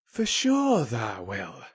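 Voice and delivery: slow, stout Yorkshire reassurance; stout assurance. A narrator's reading. Dickon assuring Colin he will walk, stout. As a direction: stretch it out firmly. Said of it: "he said stoutly".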